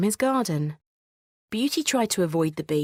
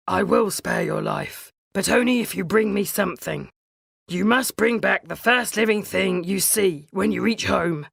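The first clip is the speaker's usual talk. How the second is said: stern beast; 3 dB louder than usual. Acted. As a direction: lay down the terms firmly. Beast sets his condition.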